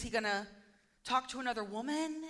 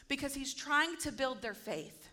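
normal talking voice

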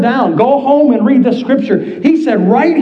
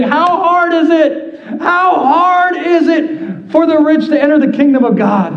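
raised voice; 6 semitones higher than usual. Acted. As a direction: raise your voice in dismay at the crowd. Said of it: "Jesus raised his voice".

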